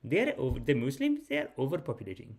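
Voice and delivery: a hint of a mild-mannered cousin; lightly imitated polite cousin. Cousin politely complains about Muslims overpopulating, polite imitation. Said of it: "He's so polite, too".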